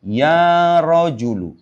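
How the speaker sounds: very slow Ya rojulu, stretched; slow model reading. Shown as a demonstration. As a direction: draw out Ya rojulu for the class. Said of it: "Lihat sini".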